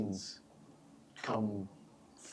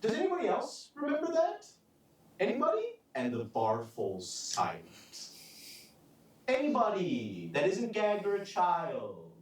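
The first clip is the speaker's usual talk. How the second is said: bullying interrogator; 10 semitones higher than usual. Acted. Villain interrogates silent bar crowd.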